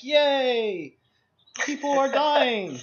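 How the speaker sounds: mock cheer. Cheering the dinosaur killing people, mock glee. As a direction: cheer sarcastically at the carnage. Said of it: "And I'm like".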